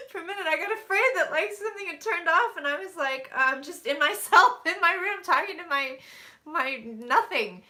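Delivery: loud, high teary laugh-crying. Real, not acted. Psychic tells viewers she got scared, real crying. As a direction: talk through real tears. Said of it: "I can't believe I'm fucking crying".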